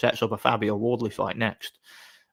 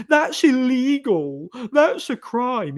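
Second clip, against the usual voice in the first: much higher, slow, whiny screech; mock-outraged Redditor shrieking, higher and slow; 13 semitones higher, 5 dB louder, slower than usual. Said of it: "all the Redditors will screech".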